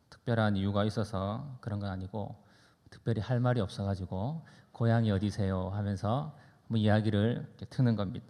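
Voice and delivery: ordinary talk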